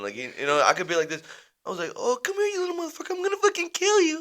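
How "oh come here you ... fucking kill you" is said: very high cooing dog-owner voice; sweet pet-talk tone. Shown as a demonstration. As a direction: coo threats as if doting on a puppy. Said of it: "He just knows the tone".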